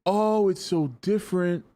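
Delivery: high, slow mocking gush; mocking quote. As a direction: parody a shallow fan's praise. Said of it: "It's always because".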